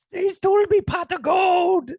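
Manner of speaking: high, loud, slow mock-Irish leprechaun; bad Irish accent. Host answering with his own leprechaun, hammed-up Irish that veers Scottish. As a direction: strain up high for a badly faked Irish brogue. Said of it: "could be also Scottish too".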